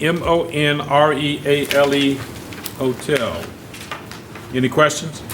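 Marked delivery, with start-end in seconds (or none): none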